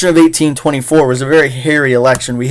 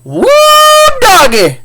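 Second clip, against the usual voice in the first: whooping southern farmer; 5 semitones higher, slower than usual. Acted. Farmer James McPoor cheers the giant cheese party, high southern whooping.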